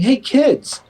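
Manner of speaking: gee-whiz family outing pitch, laying on fake enthusiasm; mock eager parent. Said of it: "imagine a family in Montreal going".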